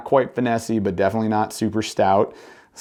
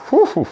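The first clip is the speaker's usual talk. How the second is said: sharp high whew of amazement; high impressed whew; impressed whistle-whew; 3 dB louder than usual. Real, not acted. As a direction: let out a high whew at the bait. Reacting to the best jig.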